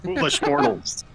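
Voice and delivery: sly booming spirit; lightly voiced scheming spirit; deceptive spirit voice. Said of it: "same successfully deceptive voice".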